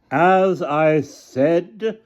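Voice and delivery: high, very drawn-out insistence. A narrator's reading. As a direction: raise it high to override the heckler. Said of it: "Hannah Frankenstein raised her voice".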